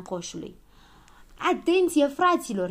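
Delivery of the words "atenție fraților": loud, raised call to attention; high, commanding shout. A narrator's reading. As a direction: call the whole group to order. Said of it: "strigă el".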